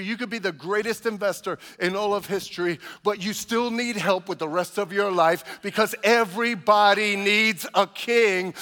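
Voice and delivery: building sermon. Real, not acted. Preaching builds to climax.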